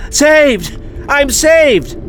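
very high, exultant shout; relieved cry. Acted. Finfalaflix crashes into the tardis while falling, elated high cry.

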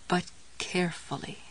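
soft, careful murmur. A narrator's reading. Jessie cautioning herself as she handles the jar, murmured. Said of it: "she murmured".

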